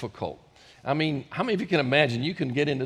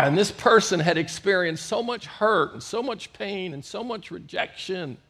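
ordinary talk, nothing marked

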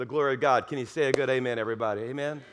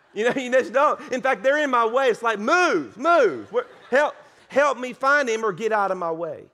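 acted-out frantic impatience, louder and higher; panicked searching; 5 semitones higher, 6 dB louder than usual. Frantic dad to bystanders while hunting his lost son, acted-out panic. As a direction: push people aside as if searching in a panic. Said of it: "freaking out, panic, crazy".

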